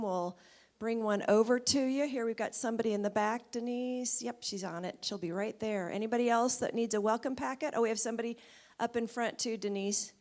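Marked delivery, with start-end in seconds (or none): none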